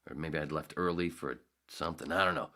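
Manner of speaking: ordinary delivery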